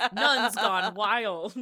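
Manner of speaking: slow, loud title through laughter; joking title read out laughing. Real, not acted. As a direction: announce it while cracking up. Joking fake show title.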